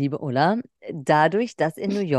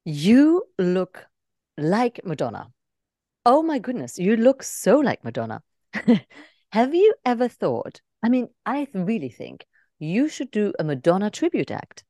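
put-on lightly British-accented gushing; an English waitress customer voice; 3 semitones higher than usual. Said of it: "englischen Akzent".

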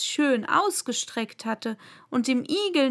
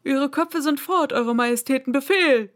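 loud, higher soldiers' report; shouted reply; 4 semitones higher, 5 dB louder than usual. A narrator's reading. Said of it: "schrien die Soldaten als Antwort".

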